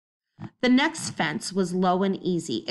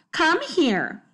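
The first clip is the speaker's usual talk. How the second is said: higher, prim and disapproving command; high stern neighbour; 9 semitones higher than usual. Acted. Missus Trance ordering Bean closer, high prim voice. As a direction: summon the child sharply. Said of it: "she said in her high voice".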